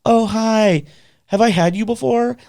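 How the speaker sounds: high, sociable hello; friendly greeting. Retold or imitated. Patient greeting a new nurse from bed, friendly.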